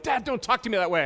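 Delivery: high, loud mock kid whine; imitating annoyed kid. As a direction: mimic a kid protesting. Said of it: "they're like".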